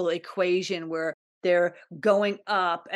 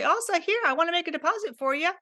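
high, quick, eager voice of the universe; the universe talking; 9 semitones higher than usual. Retold or imitated. The universe offering her a deposit, in a high, quick, eager voice. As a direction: play the universe as a bubbly helper offering money. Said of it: "for the universe to say".

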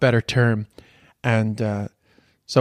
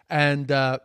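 ordinary delivery

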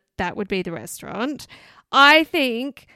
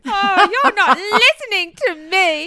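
teasing, laughing, higher; laughing mock retort; 8 semitones higher, 6 dB louder than usual. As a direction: laugh through mock complaint. Mock quoting his complaint.

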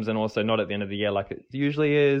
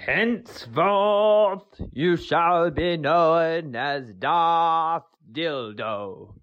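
slow, higher, dramatic mock dark lord decree; Sith lord voice; 6 semitones higher, much slower than usual. Listener hamming up a Sith lord to dub himself, slow dramatic pronouncement. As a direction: intone it like a movie villain knighting someone.

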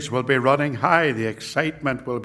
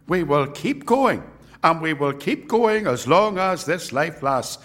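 roaring rallying speech; 4 semitones higher than usual. Acted. Rallying climax of sermon.

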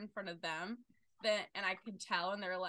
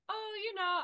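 calm explaining; 12 semitones higher, 3 dB louder than usual. Retold or imitated. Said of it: "very calmly just explain".

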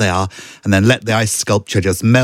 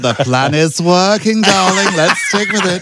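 slow, higher, camp host drawl; game show host voice; 7 semitones higher than usual. Shown as a demonstration. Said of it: "drifting into Del Winton now".

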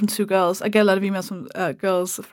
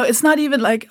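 ordinary talk

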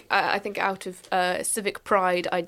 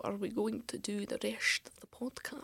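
whispering; 11 dB quieter than usual. Real, not acted. Aside to co-hosts.

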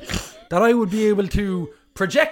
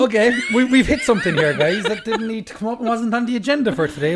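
talking through laughter; giggling while speaking; laughing; 6 semitones higher, 3 dB louder than usual. Real, not acted. Laughing at partner's mispronunciation.

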